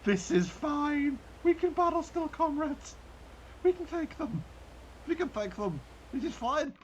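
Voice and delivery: high, hammed-up German commander rallying comrades; over-the-top German accent, high and insistent; mock German accent. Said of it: "I don't know why I'm German now".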